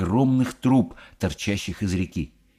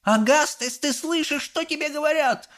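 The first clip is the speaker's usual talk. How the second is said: very high scolding mother; Mrs Gloop. A narrator's reading. Mother scolds her deaf son, high holler. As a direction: holler high, without much extra volume. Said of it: "заорала миссис Глуп".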